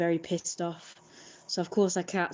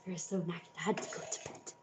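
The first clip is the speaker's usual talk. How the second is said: tired whisper; 8 dB quieter, faster than usual. Real, not acted.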